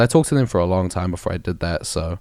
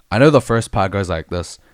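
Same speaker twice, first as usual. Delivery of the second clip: ordinary talk, nothing marked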